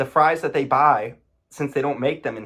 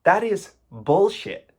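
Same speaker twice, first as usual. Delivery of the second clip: incredulous, rising indignation; disbelieving rant; outraged; 6 semitones higher, 4 dB louder, slower than usual. Real, not acted. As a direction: call out the lie, fired up. Outraged at the ad claim.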